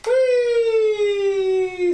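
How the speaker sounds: high gleeful squeal; excited whee. Real, not acted. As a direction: squeal with delight as it dives. Flying drone through stairwell.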